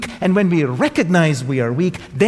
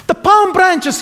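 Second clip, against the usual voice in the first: much higher and louder cry; shouting; 8 dB louder than usual. Acted. Ignored donkey shouting at the market crowd, loud and high. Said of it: "he shouted".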